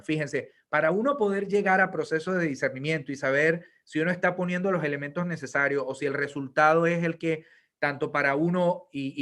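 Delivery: ordinary delivery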